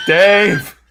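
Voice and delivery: high, loud mock call across the valleys; loud, high-pitched pretend shout into the distance; calling out a name. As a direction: holler the name as if searching far off. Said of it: "just, like, calling his name".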